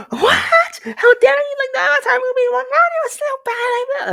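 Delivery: very high, loud shrieking outrage; hammed-up fanboy screech; mock outraged scream. Avatar fanboy screaming at a girl, shrill mock outrage. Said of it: "I started screaming".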